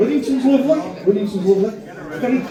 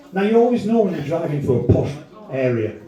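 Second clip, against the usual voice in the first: usual speaking voice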